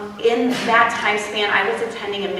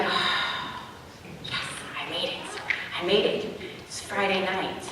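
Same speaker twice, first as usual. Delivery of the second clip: relieved hush; 3 semitones lower, 10 dB quieter than usual. Retold or imitated. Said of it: "it would be like".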